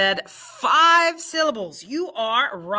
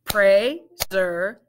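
"Perseverance" broken into parts; syllable by syllable; split into syllables; 3 dB louder than usual. Shown as a demonstration. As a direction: segment "Perseverance". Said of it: "Let's blend it".

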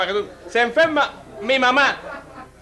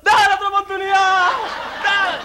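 crowd yelling; 9 semitones higher, 4 dB louder than usual. Acted. Family begs the executioner even after a right answer, shouting high. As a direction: bellow it as a whole family begging. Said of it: "Dice la familia".

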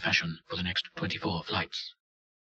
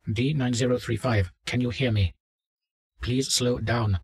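louder, higher doctor voice; doctor calling out; 5 semitones higher, 7 dB louder than usual. Acted. Doctor hails a subject fleeing upstairs, calling out firmly. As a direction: call to someone who is not responding.

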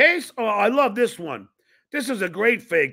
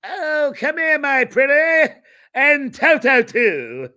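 a light cackling-witch voice, a bit higher, the last words slowed and laughing; Wicked Witch impression; 4 semitones higher, 4 dB louder, slower than usual. Host mocking a guest in a light witch voice. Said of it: "the Wicked Witch of the West".

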